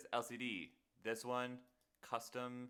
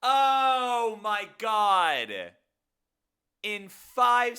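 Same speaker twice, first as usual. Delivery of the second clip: loud, slow, high exclamation; stunned shout; 10 semitones higher, 14 dB louder, much slower than usual. Real, not acted. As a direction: cry out in disbelief. Realizes the easy fix.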